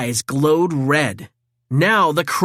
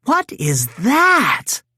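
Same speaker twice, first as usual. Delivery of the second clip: slow, stunned higher question; gasping; 7 semitones higher, much slower than usual. A narrator's reading. April seeing Metalhead for the first time, slow stunned question. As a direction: stare and ask slowly in shock. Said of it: "She gasped when she saw Metalhead".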